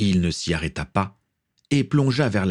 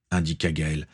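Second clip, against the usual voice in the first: ordinary delivery, nothing marked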